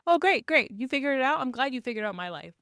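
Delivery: mock gratitude, dripping with scorn; sarcastic fury. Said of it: "And she's fucking pissed".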